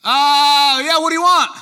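loud, high yell from a window; screaming out. Acted. Answering the visiting mayor from a window, screaming out. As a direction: holler down at an unwanted caller. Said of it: "You scream out, you open the window".

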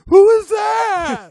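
very high, very loud, slow scream; frantic shout. Retold or imitated. Mister Spindle demanding who called, drawn-out high shout. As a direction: scream it down the phone. Spindle yelling into dead phone line.

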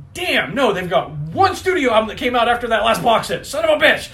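loud, high-pitched frustrated outburst; mock outrage. Collector retelling finding one album missing, exasperated. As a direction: build to a yelled, exasperated curse.

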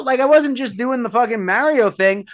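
ordinary delivery

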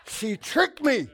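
loud, high, slow cry of betrayal; mock outrage. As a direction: play up being cheated, for laughs. Mock outrage over the diet milkshake.

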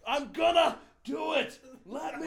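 put-on high, loud, slow drunken bravado; mock tough-guy shout; macho shout. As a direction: yell it like a drunk guy showing off. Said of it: "He's like".

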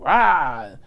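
loud, sudden roar; mock jump scare. The comic imitates a man leaping from a closet, loud roar. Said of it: "jumped out the closet and was like".

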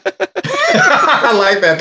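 high, slow laughing words. Real, not acted. Amused cohost laughing at the Borg Queen scene. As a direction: laugh the line out, delighted.

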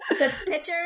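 put-on Midwest accent. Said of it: "make fun of her Western accent".

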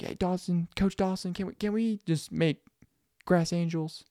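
put-on high, soft little-kid voice; pleading kids. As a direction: imitate children asking the coach a favor. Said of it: "they were all telling me".

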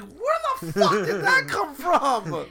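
high, loud, slow outburst; exasperated disbelief. Retold or imitated. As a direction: demand where the slang came from. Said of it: "I'm like".

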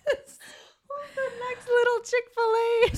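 very high, slow, squeaky with laughter; laughing self-mockery. Podcaster mocks herself as biggest flirt, laughing high squeal. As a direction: squeal the catchphrase slowly through giggles.

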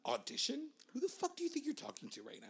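high, soft diva indignation; hammed-up hushed outrage; mock offended star. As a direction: act haughtily affronted in a small high voice. Mocks an offended star being asked.

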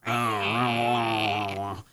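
low, drawn-out imitated cat grumble; glaring cat. Imitating the cat handed off to Madison, glaring. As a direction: imitate a cat's displeased noise. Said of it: "she glares at her and, like, kinda goes".

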